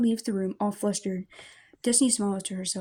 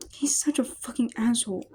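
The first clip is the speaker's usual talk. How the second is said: tearful whisper; 2 semitones higher than usual. Acted. Crying girl vents to brother.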